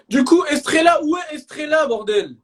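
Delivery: loud call across the room; loud call out for Estrella. Real, not acted. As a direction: shout her name out. Streamer shouting for missing girl.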